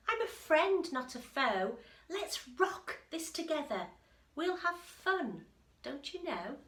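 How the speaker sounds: a small friend reassuring a big one; mouse peeping. Acted. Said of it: "Mouse peeped".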